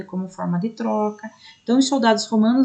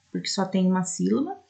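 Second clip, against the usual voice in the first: ordinary delivery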